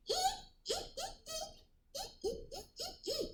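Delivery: tiny, very high monkey ee-ee; monkey squeaks. A narrator's reading. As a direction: squeak softly like a cheeky monkey. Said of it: "and they said".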